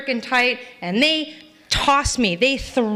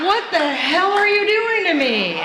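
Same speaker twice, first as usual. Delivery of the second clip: high-pitched comic outrage; mock indignation; 8 semitones higher than usual. Drunk driver to officer beating her, mock indignation. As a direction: protest in exaggerated disbelief.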